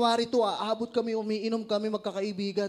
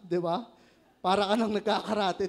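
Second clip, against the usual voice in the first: ordinary talk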